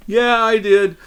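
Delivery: high-pitched imitation of eager kids; kids' chorus, imitated; kids answering. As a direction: answer up high like a classroom of children. Voicing the kids answering teacher.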